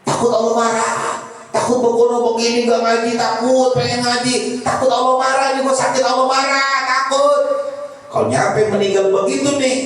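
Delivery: high-pitched sermon voice dwelling on fear; fervent preaching. Acted. Preacher acting out fear of Allah's anger, fervent preaching. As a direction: keep the pitch up and press each "takut".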